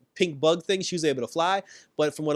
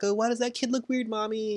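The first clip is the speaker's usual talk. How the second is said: put-on child's voice; 8 semitones higher than usual.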